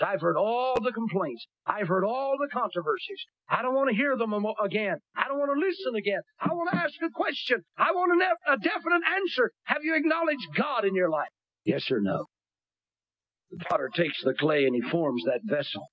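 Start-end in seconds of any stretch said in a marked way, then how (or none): 3.5-12.3 high, insistent demand; fiery preaching. Acted. Preacher demands a yes or no, high and insistent. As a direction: press them for an answer.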